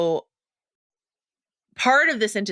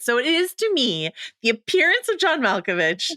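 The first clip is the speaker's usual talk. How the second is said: normal talking voice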